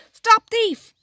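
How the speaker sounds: high, loud angry shout after a thief; calling out. Acted. Gardener shouting after the rabbit thief, loud and high. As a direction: yell it as the gardener gives chase. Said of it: "calling out".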